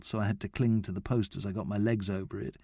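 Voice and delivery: ordinary delivery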